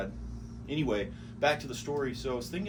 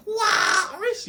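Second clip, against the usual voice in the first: friends' high, loud whooping holler; imitated name call; 13 dB louder than usual. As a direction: whoop it like friends hailing a buddy. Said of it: "they'd be like".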